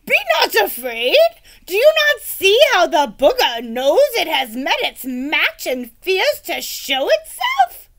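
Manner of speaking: loud, high pompous reassurance; gallant knight. Acted. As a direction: declaim it bravely.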